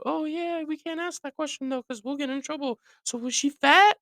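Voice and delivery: very high falsetto busybody; mock gossip in a squeaky high voice; put-on falsetto, prying; nosy onlookers.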